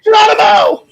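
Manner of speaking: loud, high whooping cry; Geronimo shout. Pretend. Host shouts the Doctor's catchphrase to cheer the act.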